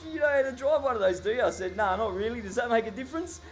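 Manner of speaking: high, loud mock courtroom exchange; amused judge. Judge teasing the teen in court, high and amused. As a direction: play the judge teasing, then the kid deadpan.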